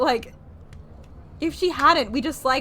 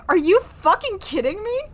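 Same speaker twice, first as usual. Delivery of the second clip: louder, higher mock outrage; 10 semitones higher, 10 dB louder than usual. Streamer voicing Darklaw reacting to Kira, loud mock outrage. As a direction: blurt it as if fed up and disbelieving. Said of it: "Darklaw's like".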